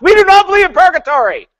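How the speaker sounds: hammed-up screaming denial; mock screaming. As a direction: yell it like all caps text. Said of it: "screaming at you in all caps".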